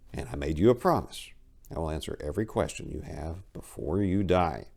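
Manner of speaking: hushed. Acted. Cyril hushing the dying narrator, soft murmur. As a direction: soothe her in a low murmur.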